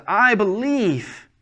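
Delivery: high, loud, drawn-out declaration; acted heartfelt outcry; crying out. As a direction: cry it out, stretching the words. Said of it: "The man cries out".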